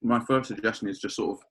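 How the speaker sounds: talking with ice in mouth; mouth full of ice. Real, not acted. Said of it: "sorry, I've got a lot of ice in my mouth".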